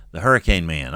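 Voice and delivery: normal talking voice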